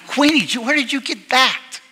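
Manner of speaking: disbelieving, can't believe he asked that; incredulous. Retold or imitated. Coach reacting in loud disbelief to Bill's question.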